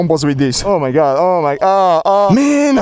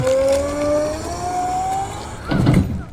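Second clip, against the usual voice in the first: high breathy laughter; high giggle; 7 dB quieter than usual. Real, not acted. Thrilled at scooter sound.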